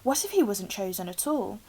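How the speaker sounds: plain reading voice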